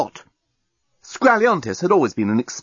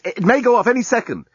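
high-pitched stammered urgency; urgent snapping; 11 semitones higher, faster than usual. A narrator's reading. Journalist warns of bomb heading out, urgent snap. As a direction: stumble on the first word, hurried and irritated. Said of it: "snapped the journalist".

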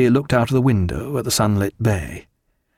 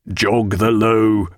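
first cry; much slower than usual. A narrator's reading. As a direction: call the order out slowly, holding back some force. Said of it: "cried Mister Roke".

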